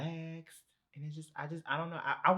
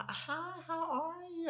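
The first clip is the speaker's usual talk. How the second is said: very high, drawn-out bougie greeting; slower than usual. Retold or imitated. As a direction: sing out an exaggerated, slow, high wannabe-bougie auntie.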